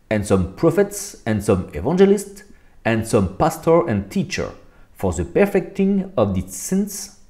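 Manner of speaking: slow, deliberate reading of the English verse. Acted. Reading the English Bible to his listeners, slow and careful. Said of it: "on rigole pas de mon accent".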